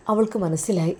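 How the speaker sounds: usual speaking voice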